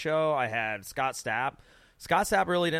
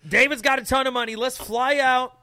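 loud, high and fired up; rally everyone for a backyard brawl; hyped challenge; 9 semitones higher, 6 dB louder than usual. Real, not acted. Host hyping a backyard fight between bands, loud and high.

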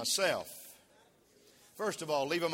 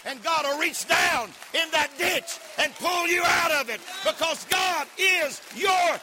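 preaching at full force; 12 semitones higher, 9 dB louder than usual. Acted. Pastor shouting to the congregation, full force. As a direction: build up and shout it to the church.

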